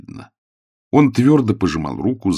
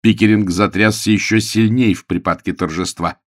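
ordinary delivery

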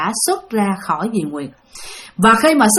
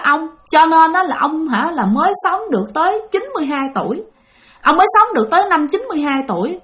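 higher-pitched voice of a dead wife, retold; another woman's voice; 5 semitones higher than usual. The dead wife, through the possessed aunt, speaks toward the coffin in a higher woman's voice. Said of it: "mà là một cái giọng đàn bà khác".

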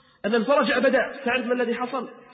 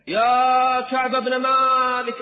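high, drawn-out distant call; herald's long, ringing hail; calling from afar; 5 semitones higher, 5 dB louder, much slower than usual. Acted. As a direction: call the name out with long-held vowels. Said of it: "وصرخ بأعلى صوته".

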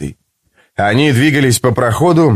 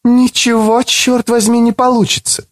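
lightly voiced librarian woman; gentle lecturing woman; female character voice; 12 semitones higher than usual. A narrator's reading. Astriza character voice.

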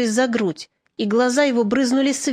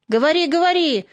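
higher, mildly raised urging call; encouraging shout; 6 semitones higher than usual. A narrator's reading. Crowd urging a hesitant comrade to speak, encouraging shout. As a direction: egg him on to speak. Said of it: "закричали поощрительно".

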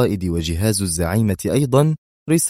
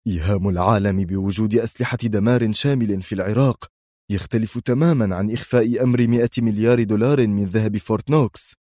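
usual speaking voice

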